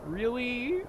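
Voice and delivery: high, drawn-out rising question; whiny disbelief. Real, not acted. Player dreading the walk back, high incredulous whine. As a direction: stretch it upward in pained disbelief.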